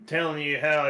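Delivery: usual speaking voice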